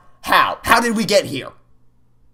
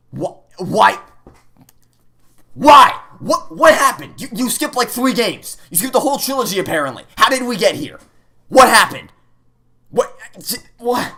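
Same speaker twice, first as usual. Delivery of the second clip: high, loud disbelief; incredulous; 6 semitones higher, 5 dB louder than usual. Real, not acted. Player to the game, high pitched shock. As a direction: demand answers, baffled.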